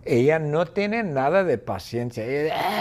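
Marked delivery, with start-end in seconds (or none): none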